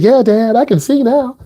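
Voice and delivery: lightly voiced daughter, pleased; daughter's voice. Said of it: "She said".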